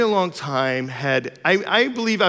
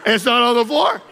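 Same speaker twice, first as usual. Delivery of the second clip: son arguing; 8 semitones higher than usual. Retold or imitated. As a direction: argue like a clever child who found a loophole. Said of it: "He's like".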